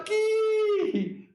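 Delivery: um "que" agudo e esticado, incrédulo; espanto imitado. Retold or imitated. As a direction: reaja com indignação exagerada, alongando a palavra. (espanto agudo, encenado)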